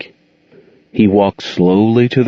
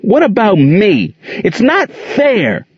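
put-on high upset child's protest, slow on the end; a hurt boy lashing out; angry boy; 13 semitones higher, slower than usual. A narrator's reading. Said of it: "Gerald cried angrily".

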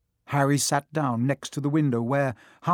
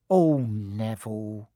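put-on lower, slow, weary old woman's sigh; sighing old gran; 4 semitones lower, slower than usual. A narrator's reading. Gran sighing fondly at forgetful grandson. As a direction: let it out like a tired grandmother. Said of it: "he heard the old woman sigh".

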